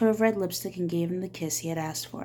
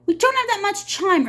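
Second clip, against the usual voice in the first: high, loud urgent plea; urging; 13 semitones higher, 6 dB louder than usual. Acted. Miss Catherine urging the sheriff, high and loud. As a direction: press him to hurry. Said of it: "urged Catherine".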